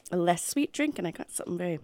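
ordinary delivery

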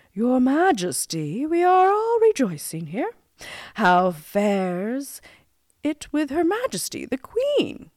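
ceremonious address, starting high and loud, then easing; grand courtier's measured question; courtly Lord High Marshal. A narrator's reading. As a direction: inquire formally of a king.

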